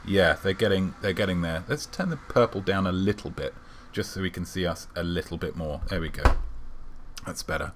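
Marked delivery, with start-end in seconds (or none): none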